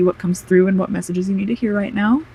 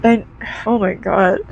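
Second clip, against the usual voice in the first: crying; 4 dB quieter than usual. Real, not acted. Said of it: "not gonna apologize for crying".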